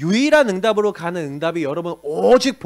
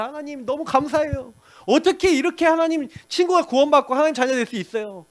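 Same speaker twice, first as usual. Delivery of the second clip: joyful prayer; 8 semitones higher than usual. Retold or imitated. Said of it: "기뻐갖고 정식기도 하면서".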